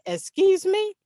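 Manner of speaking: high, loud incredulous question; imitated startled son. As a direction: mimic a son taken aback. Son's startled reply to mother.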